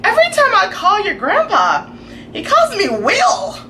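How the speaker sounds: imitating mom. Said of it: "my mom has said".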